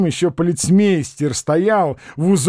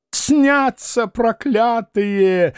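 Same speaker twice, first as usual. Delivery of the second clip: high, very slow complaining cry; a drawn-out high moan; old man's wail; 8 semitones higher, much slower than usual. A narrator's reading. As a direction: drag it out like a cursed old man. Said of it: "донёсся до Остапа голос старика".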